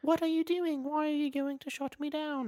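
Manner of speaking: a bad imitation of a prim British child; terrible British little girl. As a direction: squeak it out like a creepy hologram girl. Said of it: "She's a British little girl".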